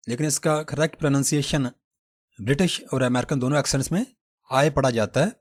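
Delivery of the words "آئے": آئے said as "eye", a clear diphthong; correct pronunciation. Shown as a demonstration. Teacher showing students the right way: آئے. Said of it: "آئی پڑھنا اس کو غلط ہے".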